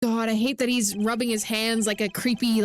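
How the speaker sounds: ordinary delivery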